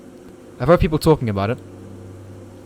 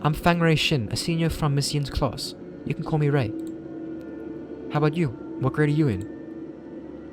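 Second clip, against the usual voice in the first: put-on lighter, higher girl's voice; young woman voice; 5 semitones higher, 4 dB quieter than usual. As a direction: play the friendly senior girl.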